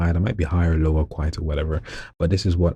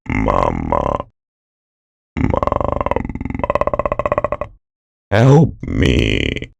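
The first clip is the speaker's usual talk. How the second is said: very low, slow voice made on an in-breath; inhaled creepy voice; 10 semitones lower, 4 dB louder, much slower than usual. Shown as a demonstration. Showing viewers voice number four, a creepy inhaled plea. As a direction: speak while breathing in, eerie. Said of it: "very creepy effect".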